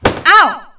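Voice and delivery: sharp, loud cry of pain; pained yelp. Acted. Kitty is hit in the face, loud yelp of pain. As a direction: yelp as the punch lands.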